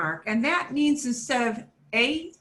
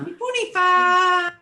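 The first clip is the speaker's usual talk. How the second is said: loud call; 8 semitones higher, 6 dB louder than usual. Shown as a demonstration. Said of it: "spoken as a call in class".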